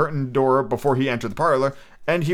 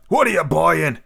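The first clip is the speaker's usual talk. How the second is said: lightly voiced gruff wizard, louder; Mad Eye Moody voice; 4 semitones higher, 4 dB louder than usual. Shown as a demonstration. Reader warming up a Mad Eye Moody voice, louder and gruff. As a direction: warm up the voice with a key phrase. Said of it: "key phrase, uh, for Mad Eye Moody".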